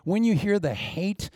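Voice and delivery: normal talking voice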